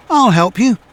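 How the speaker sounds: high, bright helpful bus voice; lightly voiced friendly bus; kind bus. A narrator's reading. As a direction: offer help cheerfully as Bertie. Bertie voiced in story.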